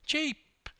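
very high mocking squeak; high sarcastic quip; retort. A narrator's reading. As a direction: toss back a sarcastic retort. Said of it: "retorted Hammer".

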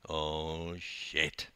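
low, hushed gasp of alarm; gasped dread. A narrator's reading. Narrator spotting the youths in the car park, gasped. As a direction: let it out softly under the breath. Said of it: "I gasped".

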